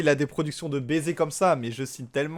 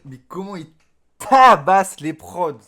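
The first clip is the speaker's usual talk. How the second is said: much louder, slow "tabasse les prods?"; amazed exclamation; 2 semitones higher, 10 dB louder than usual. Real, not acted. Streamer amazed at how the rapper handles the beats. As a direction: punch it out in awe.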